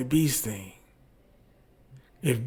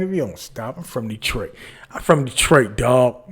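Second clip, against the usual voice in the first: ordinary talk, nothing marked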